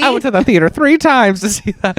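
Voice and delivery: put-on high old-timer voice; old moviegoer reminiscing; very old man. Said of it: "the old man voice time immediately".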